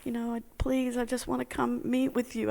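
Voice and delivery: high, whimpering plea, imitating sobs; mock sobbing. Overwhelmed student begging her teacher by phone, mock sobbing. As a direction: plead in a put-on tearful voice. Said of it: "really sobbing and like".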